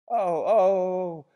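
loud, high ecstatic "oh"; inarticulate cry. A narrator's reading. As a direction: cry out wordlessly in rapture. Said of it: "inarticulately testified".

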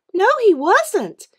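high, loud, slow denial; stubborn kid sister, high and loud; insisting. A narrator's reading. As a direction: stamp her foot on it, high and loud. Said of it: "Mary insisted".

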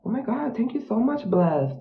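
bright gushing gratitude; high excited thanks. Real, not acted. Streamer thanks a gifter, high and excited.

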